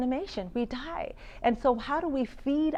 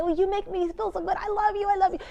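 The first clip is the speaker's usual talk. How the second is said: delighted, much higher and louder; gushing reply; 9 semitones higher, 4 dB louder than usual. Retold or imitated. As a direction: pour your affection back at her. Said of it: "And I say".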